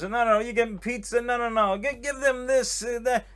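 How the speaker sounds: put-on high, insistent old-school host; hammed-up insistence, pitched well up; Lenny. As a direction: imitate a host waving away the order. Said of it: "and then he goes".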